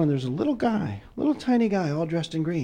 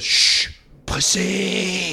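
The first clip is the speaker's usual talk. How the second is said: drawn-out, higher hushing; rooster voice; 3 semitones higher, 4 dB louder, much slower than usual. Acted. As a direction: hush slowly, in a rooster's put-on voice. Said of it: "The rooster goes".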